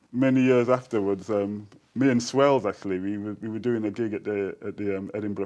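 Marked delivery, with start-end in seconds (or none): none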